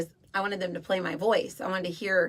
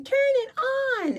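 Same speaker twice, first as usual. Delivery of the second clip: put-on high, slow childish wail; whiny little kid; slower than usual. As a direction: whine it like a small child. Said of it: "little kid going".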